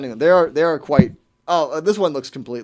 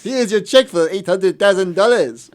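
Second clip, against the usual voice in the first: higher, louder and slow, with a hammed-up French accent; mock French accent; 6 semitones higher, 7 dB louder than usual. French woman sends scammer the check, hammed-up French accent. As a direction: grandly hand over the check in a silly French accent. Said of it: "I kept doing the French accent".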